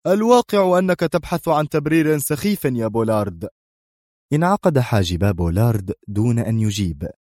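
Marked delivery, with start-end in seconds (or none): 0.1-3.5 high-pitched, severe rebuke; stern. A narrator's reading. Boss dismisses his subordinate's silly excuse, sternly. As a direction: cut him off with strict authority. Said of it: "أجاب الرجل في صرامة".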